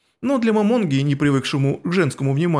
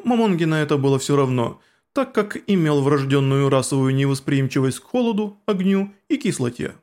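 plain reading voice

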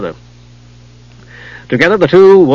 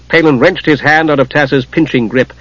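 usual speaking voice

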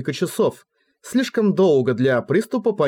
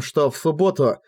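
ordinary delivery, nothing marked

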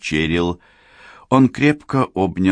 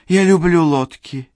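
high, louder delighted voice; excited; 11 semitones higher, 5 dB louder than usual. A narrator's reading. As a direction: gush about boats, much higher. Cheryl excited about boats.

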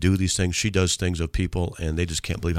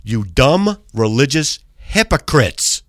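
slow, louder mock-angry read; reading hate mail; 5 semitones higher, 7 dB louder, slower than usual. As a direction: ham up the angry writer slowly. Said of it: "Here we go".

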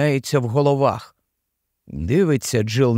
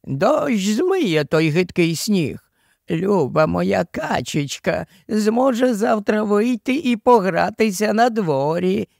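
high, sing-song cooing nanny; old nanny; 6 semitones higher than usual. A narrator's reading. Nanny tucking Jill in for the night, high cooing. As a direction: coo soothingly in a raised old-woman voice. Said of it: "відповіла стара".

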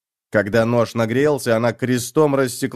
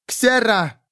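shouted cry; 10 semitones higher than usual. A narrator's reading. Girl cries out as she jumps at lad, shouting. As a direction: cry it out on a high pitch. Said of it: "крикнула она".

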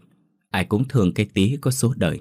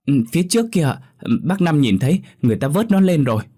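put-on high, frightened child's report; trembling; 8 semitones higher, 4 dB louder, faster than usual. Frightened child pointing out where the body is, trembling. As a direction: speak up high as if shaken. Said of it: "nó run run nói".

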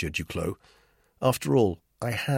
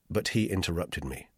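ordinary delivery

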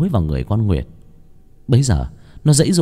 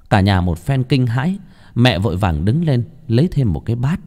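ordinary delivery, nothing marked

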